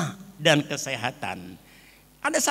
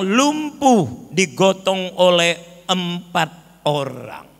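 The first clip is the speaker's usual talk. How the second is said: loud, measured pulpit reading; scripture reading; 7 dB louder than usual. Acted. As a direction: proclaim each phrase firmly to the congregation. Reads scripture aloud to congregation. (pembacaan Alkitab yang lantang dan tegas)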